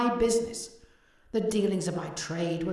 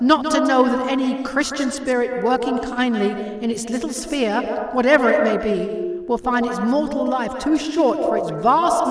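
ghostly lament; 6 dB louder than usual. A narrator's reading. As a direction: wail it like a tormented spirit. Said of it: "cried the ghost".

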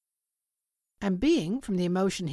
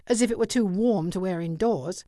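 plain reading voice

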